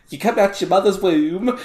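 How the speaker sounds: high, loud mock-British imitation; mock British accent. Teasing a friend's slip, mock British accent. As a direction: mimic his British accent, high and amused. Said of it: "the dichotomy between".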